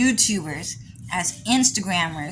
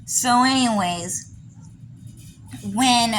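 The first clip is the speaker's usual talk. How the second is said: ordinary talk, nothing marked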